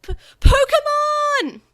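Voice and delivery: very loud, high, slow stammered scream; scared boy shrieking for his partner; cry out. A narrator's reading. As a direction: wail the name. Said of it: "And even Joe cried out".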